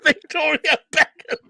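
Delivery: high, loud words through real laughter; helpless laughter. Real, not acted. Host repeating friend's sponge joke, cracking up. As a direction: barely get the name out for laughing.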